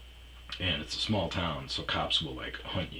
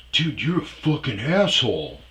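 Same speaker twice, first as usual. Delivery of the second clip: higher, loud outraged scolding; mock indignant bartender; 9 semitones higher, 10 dB louder than usual. Imitating bartender scolding him over the girlfriend, indignant. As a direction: tell him off, appalled and loud.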